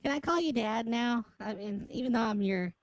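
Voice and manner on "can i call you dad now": usual speaking voice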